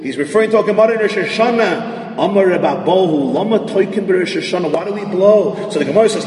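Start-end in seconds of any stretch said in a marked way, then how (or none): none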